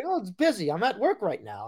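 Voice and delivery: high mock Italian accent; singsong Italian-accented impression. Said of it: "strong Italian accent".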